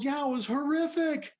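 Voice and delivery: high, loud mock-angry shout; shouted agreement. Cut-off man agreeing with the driver, shouting. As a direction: bellow back in heated agreement, imitating the man. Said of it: "he's still shouting at me".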